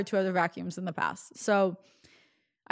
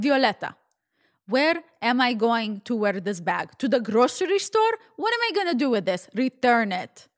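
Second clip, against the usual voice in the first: exasperated mother in a mock Russian accent; poor Russian accent; 3 semitones higher, 4 dB louder than usual. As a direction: put on a thick, amateur Russian accent, indignant. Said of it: "my really poor Russian accent".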